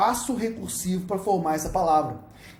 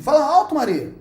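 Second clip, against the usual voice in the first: Yoda-style order; 8 semitones higher, 7 dB louder than usual. Shown as a demonstration. Says the Yoda-style sentence.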